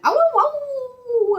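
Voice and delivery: very high, slow, loud howling; drawn-out high dog howls; wild dog howl. Acted. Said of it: "the Siberian wild dog went".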